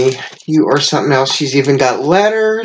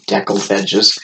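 laughing at herself; 8 semitones lower than usual. Real, not acted. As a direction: giggle through the word at your own slip. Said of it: "I can't even talk".

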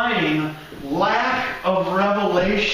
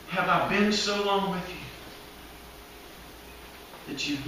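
quiet Jesus; 3 semitones lower, 6 dB quieter than usual. Retold or imitated. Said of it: "And Jesus is like".

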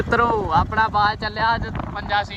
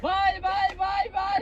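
much higher, sing-song calls to a hawk; very high bird call; 13 semitones higher than usual. Real, not acted. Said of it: "ਬਾਏ ਮਾਰ ਉੱਚੀ".

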